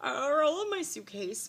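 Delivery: high, whiny fake sob; mock crying. Real, not acted. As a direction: whine it like a cry. Whining about lost suitcase.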